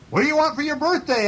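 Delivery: very high, quick echoed question; moon's echo. A narrator's reading. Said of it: "asked Moon".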